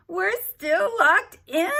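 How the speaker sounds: high, loud, slow squeal. Acted. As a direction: squeal it out in shock. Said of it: "Maybe I should say it like".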